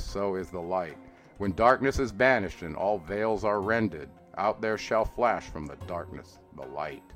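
usual speaking voice

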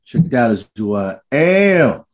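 slow, drawn-out catchphrase; low start, long drawn-out AM; upbeat sign-off. Real, not acted. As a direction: stretch out the last word with relish. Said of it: "he did it better this time. He's in a better mood".

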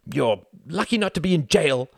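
high, loud jab; angry retort. A narrator's reading. Wendy hitting back after being silenced, angry. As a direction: throw it at him hotly. Quarrel continues.

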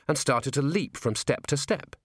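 plain reading voice

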